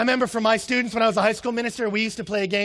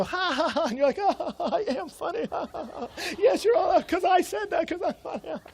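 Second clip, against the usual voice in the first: high-pitched, gloating mock laughter; hammed-up self-satisfied laughing; 9 semitones higher than usual. Joke teller basking in everyone's laughter, high mock-gloating laugh. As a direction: laugh through it, delighted with your own wit.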